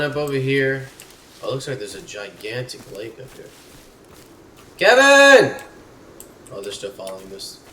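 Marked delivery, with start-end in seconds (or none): 4.8-5.6 very high, loud holler; calling out. Real, not acted. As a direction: shout for your companion at full voice. Calling out to companion.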